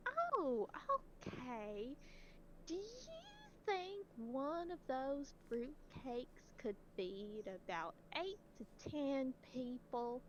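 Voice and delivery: put-on high, slow old lady voice; old lady caller.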